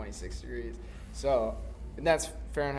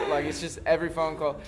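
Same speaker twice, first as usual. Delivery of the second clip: normal talking voice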